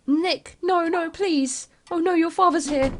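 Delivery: high, slow pleading no no please; mother begs son to keep secret; panicked pleading. Acted. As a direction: beg in panic. Mother panics as father arrives.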